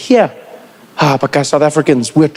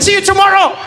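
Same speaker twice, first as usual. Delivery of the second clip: much higher cheerful shout goodbye; cheery call; 13 semitones higher than usual. Pretend. Kid leaving with the ball, calling a bright goodbye. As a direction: yell it happily over your shoulder. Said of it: "take the ball and be like".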